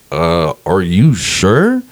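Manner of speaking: drawn-out, wary question; doubtful. Retold or imitated. Comic asks the handler about going back up, doubtful. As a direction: stretch it out slowly, unconvinced.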